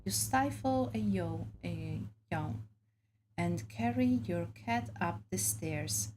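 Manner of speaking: ordinary delivery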